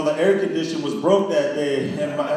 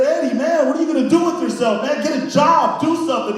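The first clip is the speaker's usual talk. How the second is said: exasperated lecture; 7 semitones higher than usual. Retold or imitated. Lecturing Eddie to get a job.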